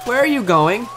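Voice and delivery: normal talking voice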